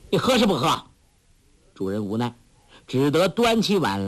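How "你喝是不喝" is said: put-on higher, bossy girl's demand; a hint of a sharp scolding girl; mock stern demand. A narrator's reading. Huang Rong tugging the host's beard, demanding, higher. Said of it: "喝道".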